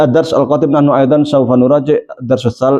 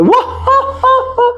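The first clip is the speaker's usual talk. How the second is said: high, mock guffaw; imitating rowdy laughing; loud laughter. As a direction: laugh out loud too much. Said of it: "Nggak boleh ketawa sampai itu".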